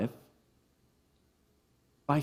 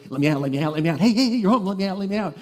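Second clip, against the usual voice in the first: eager little bird begging, rising louder on the heys; excited cockatiel; 6 semitones higher, 4 dB louder, much faster than usual. Retold or imitated. Pet cockatiel begging to be let out, lightly voiced eager pleading. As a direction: chirp it out like a bird bouncing on its perch. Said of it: "she would get so excited".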